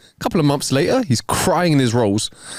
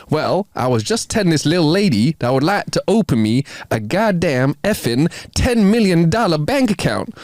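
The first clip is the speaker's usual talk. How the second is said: higher, put-on Texas drawl, drawn out toward the end; 6 semitones higher than usual. Texan replying to the bank manager, in a put-on Texas drawl. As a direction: explain it as a mock Texan, stretching the big finish. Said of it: "says in a noticeable Texas drawl".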